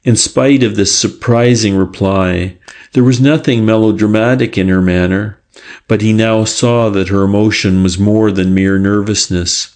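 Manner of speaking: ordinary delivery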